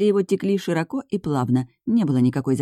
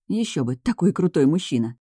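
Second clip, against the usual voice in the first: ordinary delivery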